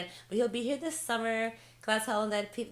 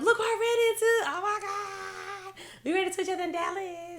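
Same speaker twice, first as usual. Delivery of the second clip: excited announcement; 11 semitones higher than usual. Retold or imitated. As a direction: squeal big news to mom, hammed up. Retells announcing cousin to mother.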